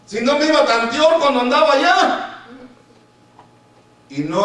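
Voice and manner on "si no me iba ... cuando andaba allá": loud, high, indignant grumbling; mock complaint. Preacher imitating believers complaining to God when things go badly, mock indignant complaint. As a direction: protest loudly like a let-down believer. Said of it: "cuando no nos salen bien las cosas y decimos".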